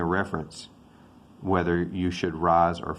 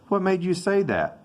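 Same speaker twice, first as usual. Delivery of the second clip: jumps way up on "What"; genuinely taken aback, voice shoots high; surprised; 12 semitones higher than usual. Acted. Teacher acting the surprised friend, pitch leaping up. Acted dialogue, surprised reply.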